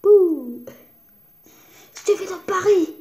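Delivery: high, loud pretend game voice; playful squeal. Girl playing inside Paris globe, squealing.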